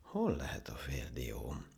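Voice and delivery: slow, soft musing to oneself; half-aloud. Acted. Monkey hunting for his lost nut, murmuring. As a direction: murmur while searching about. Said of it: "mondta félhangosan".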